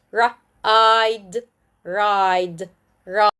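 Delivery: "ride" with a drawn-out "eye" vowel; long I. Shown as a demonstration. Teacher shows magic E words, long I. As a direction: stretch the "i" in "ride". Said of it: "With magic E".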